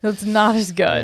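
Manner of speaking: a mock repeat of the verdict; mock echo. Echoing his co-host's verdict in a mock sulky voice.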